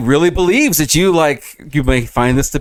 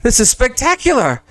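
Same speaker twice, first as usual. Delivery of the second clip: high, giddy delight; thrilled; 8 semitones higher, 3 dB louder than usual. Acted. Apprentice dreaming of a wedding, high and giddy. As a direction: gush like a lovestruck boy.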